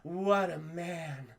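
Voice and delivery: slow, low, dreamy admiration; swooning sigh. Acted. Selina admiring Batman, slow low swoon. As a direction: savour each word. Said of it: "She goes".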